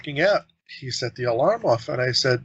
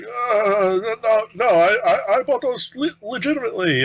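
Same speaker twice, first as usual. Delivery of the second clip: nervous shoplifter; 9 semitones higher than usual. Retold or imitated. Shoplifter caught, denying it.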